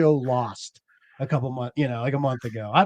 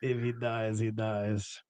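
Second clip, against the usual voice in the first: movie villain quote; 5 semitones lower, 13 dB quieter, slower than usual. Retold or imitated. Echoes movie line in put-on voice.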